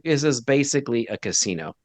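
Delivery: normal talking voice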